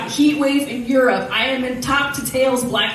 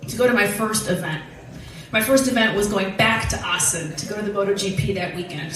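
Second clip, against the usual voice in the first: usual speaking voice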